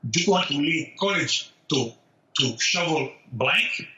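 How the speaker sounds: put-on slowing into a thick Cuban accent; Cuban-accented dad, dragging out the last words; heavy Cuban accent. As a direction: imitate a Cuban father, incredulous, word by word. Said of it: "a very heavy Cuban accent".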